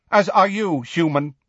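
haughty centaur reply. Acted. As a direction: cut back coldly. Bane retorts coldly.

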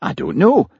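high-pitched exasperated reply; exasperated, rising denial. Acted. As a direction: protest it, voice jumping up high. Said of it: "said Jimmy, looking exasperated".

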